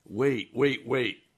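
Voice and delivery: a man running after a wagon, calling it to stop; retold shout. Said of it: "shouting at the top of his lungs".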